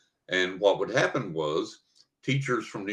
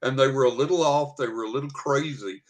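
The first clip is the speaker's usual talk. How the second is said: a light imitation of the teacher, higher and giggly; laughing teacher; 6 semitones higher than usual. Teacher explains the hermit story to the hikers, lightly imitated, higher with laughs. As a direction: retell her story with laughter bubbling through. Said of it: "and she said".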